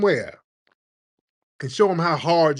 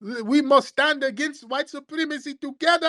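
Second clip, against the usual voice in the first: high, hammed-up imitation of a smug immigrant; mock immigrant voice; 12 semitones higher than usual. Mocking the rival group in a high put-on voice. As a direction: play the rival's sanctimonious line.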